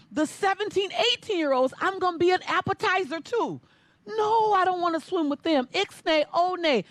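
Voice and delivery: high, frantic protest; terrified teen refusing to race older girls; panicked protest. Retold or imitated. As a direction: beg your way out of it.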